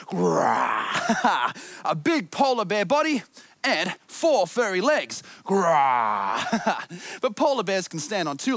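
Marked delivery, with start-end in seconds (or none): 0.1-1.5 low, playful mock roar; polar bear growl. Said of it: "Makes me feel like a polar bear". 5.4-6.4 low, playful mock roar; polar bear growl. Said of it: "Makes me feel like a polar bear".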